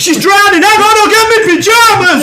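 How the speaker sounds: high, loud hammed-up flustered yelling; comic emergency shout; mock panic. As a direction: holler like a hapless rescuer, half laughing. Acting out a panicking lifeguard.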